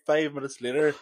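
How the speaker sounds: ordinary talk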